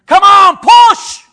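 loud shouted push command; coach shouting. Acted. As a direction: bark it like a birth coach. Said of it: "with someone coaching you".

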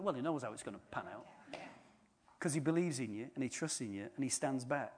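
ordinary delivery

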